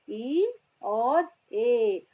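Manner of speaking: slow, long "ई", then long "ए"; two E sounds. Shown as a demonstration. Teacher naming E's two sounds, slow separated "ई और ए". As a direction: draw out "ई और ए", each vowel apart. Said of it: "alphabet ई की sound है".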